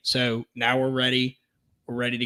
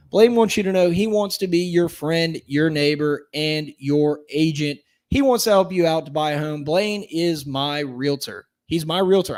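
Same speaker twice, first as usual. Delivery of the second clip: louder upbeat sponsor pitch; ad read; 3 semitones higher, 4 dB louder than usual. Acted. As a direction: sell the realtor like a radio spot. Host reads realtor sponsor ad.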